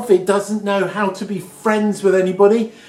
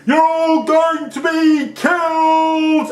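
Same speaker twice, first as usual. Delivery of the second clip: higher, slow, drawn-out warning cry; mock shouting; 10 semitones higher, 4 dB louder, slower than usual. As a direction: bellow the doom like a prophet, mock-grand. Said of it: "I've been walking three days across this town shouting".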